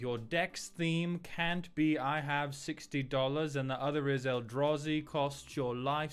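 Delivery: high, posh snob voice reading chat; the snobby aristocrat thanking subs; haughty old British aristocrat. Acted. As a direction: stay the pompous rich Brit. Keeps reading chat in character.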